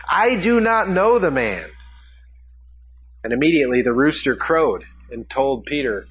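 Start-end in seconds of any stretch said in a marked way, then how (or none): 0.1-1.7 higher, firmer retelling of the oath; sworn denial. Retold or imitated. Preacher voicing Peter swearing to the bystanders, raised and insistent. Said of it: "and he swore".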